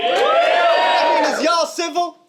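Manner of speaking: crowd shout. Real, not acted. Rapper addresses the crowd.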